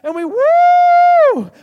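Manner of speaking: very high, loud, drawn-out woo; joyful shout. Pretend. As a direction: shout it like a party whoop. Mimicking shallow joy in worship.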